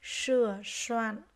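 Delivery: "Sửa soạn" very slow, each S strongly hushed; careful S sound. Shown as a demonstration. Teacher modelling "Sửa soạn" for pupils, careful drawn-out S. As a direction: lean on the S starting both words of "Sửa soạn". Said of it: "nghe cô đọc lại các từ này".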